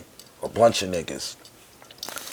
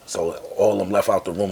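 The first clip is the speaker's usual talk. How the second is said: usual speaking voice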